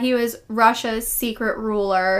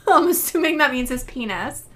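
ordinary talk

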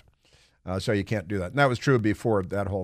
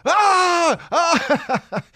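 high loud scream of thrill; 5 dB louder than usual. Retold or imitated. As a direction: scream like on a roller coaster. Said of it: "are screaming".

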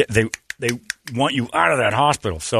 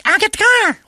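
much higher, louder chirpy voice, dashing off; eager partner darting off to fetch the car; Megan; 6 dB louder than usual. Retold or imitated. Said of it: "Megan's like".

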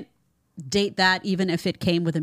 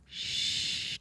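soft unvoiced shush; 11 dB quieter than usual. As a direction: hush her with a sharp shh. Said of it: "They go".

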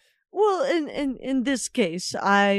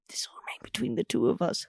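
secretive whisper; 2 semitones lower, 4 dB quieter, much faster than usual. Acted. Lady whispers to the steward that her visit is a secret. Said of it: "little intonation that this is some kind of secret".

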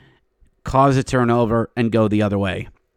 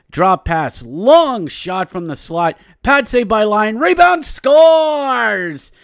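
excited goal call, rising high and loud; rapid hockey play-by-play; 7 semitones higher, 4 dB louder than usual. Real, not acted. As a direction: call the rush live like a broadcaster.

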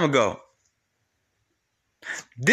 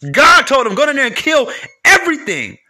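preaching loud; 4 semitones higher, 4 dB louder than usual. Acted. Preaching God's command with force.

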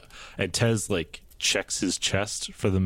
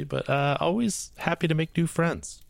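higher friendly character voice; 8 semitones higher than usual. Acted. Tez in higher voice.

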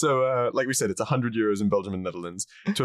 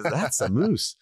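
higher-pitched put-on Canadian; Canadian accent; 6 semitones higher, much slower than usual. Host repeating the bartender's line, Canadian accent. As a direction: say it as a mock Canadian. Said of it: "that's a Canadian".

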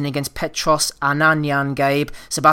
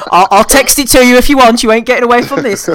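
louder, higher, laughing over the wind; raised over wind; 8 semitones higher, 10 dB louder than usual. Real, not acted. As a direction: shout it over the road noise. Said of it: "I can't hear you with the wind".

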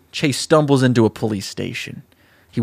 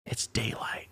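whispered narration; 3 semitones lower, 17 dB quieter, slower than usual. A narrator's reading. Narrator setting an eerie dawn scene, whispering. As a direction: whisper the line softly.

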